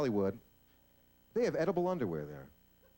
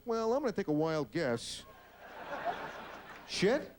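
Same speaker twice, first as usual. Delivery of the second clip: sarcastic dog; 4 semitones higher, slower than usual. Acted.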